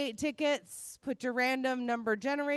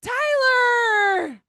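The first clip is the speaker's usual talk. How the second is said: excited call; 9 semitones higher, 10 dB louder than usual. Real, not acted. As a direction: gasp then excited shout. Winner called out.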